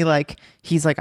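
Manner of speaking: usual speaking voice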